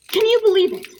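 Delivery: high, loud indignant outburst; shrill-free but loud, high outrage; outraged. Acted. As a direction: throw the question out in disbelief. Outraged letter to brother.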